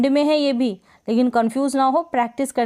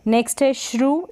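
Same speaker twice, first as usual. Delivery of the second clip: usual speaking voice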